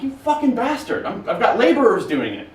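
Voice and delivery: lightly hammed-up indignant swearing; a touch of mock fury, pitched high; mock outrage. A rival's brag answered in mock outrage. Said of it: "I'm like".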